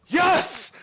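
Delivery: gleeful victory yell; triumphant yes. Acted. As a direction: cheer the win. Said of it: "He just goes".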